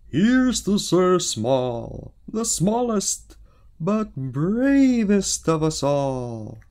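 high, loud, slow royal toast; king toasting the knight at the party; loud, drawn-out celebratory king; king's toast. Acted. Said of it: "The king said".

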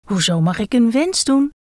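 a lightly voiced bald head, wondering; a hint of a curious bald head. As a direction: ask it with puzzled surprise. The head answers the gekko.

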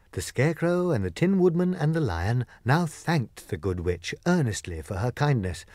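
ordinary delivery, nothing marked

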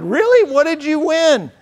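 high, loud, astonished mom asking; Really? What did you win? as a startled, delighted mother; surprised mom. Retold or imitated. Mom surprised by raffle prize.

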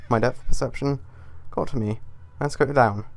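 normal talking voice

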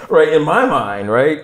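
high, loud, slow words through laughter; real laughing aside. Real, not acted. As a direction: still laughing at himself. Laughing about his own reaction.

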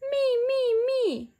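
put-on high eager children's cries; eager shout. A narrator's reading. Children begging to hold the chick, high eager shout. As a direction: shout me like excited kids. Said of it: "shout the children".

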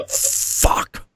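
voiceless hissed curse; long, thwarted demonic whisper. Retold or imitated. Demon finding he cannot touch him, hissed whisper. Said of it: "the voice is like".

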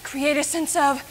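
whisper. Acted. Director selling mystery to client.

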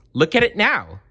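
Little Bear squeaking over the snow globe. Acted. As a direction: squeak it out with delight. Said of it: "he squeaked".

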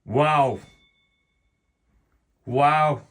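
loud excited exclamation; excited wow. Real, not acted. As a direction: cheer at the result. Reacting to the draw result.

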